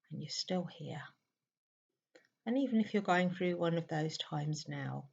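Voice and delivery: soft meditation voice with long pauses; hushed, spacious meditation guidance; guided meditation. Real, not acted. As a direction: guide a listener gently into stillness, leaving long silences.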